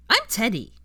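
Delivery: put-on chirpy child announcing himself; lightly voiced bright little boy. A narrator's reading. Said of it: "said Teddy brightly".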